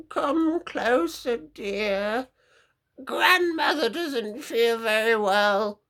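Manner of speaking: high, slow, whimpering fake granny; wolf as frail granny. Wolf lures the girl closer, high slow whimpering granny voice. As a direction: coax her closer in a high pitiful old-lady voice.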